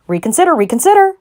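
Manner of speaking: high loud plea; crying out. A narrator's reading. Goose crying after Wilbur at the fence. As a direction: beg him to stop. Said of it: "cried the goose".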